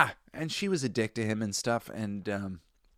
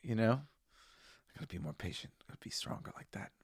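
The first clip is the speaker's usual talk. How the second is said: whispered self-talk, much softer; inner voice whisper; 6 dB quieter than usual. Retold or imitated. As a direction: whisper it to yourself as a pep talk. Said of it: "that I'm like".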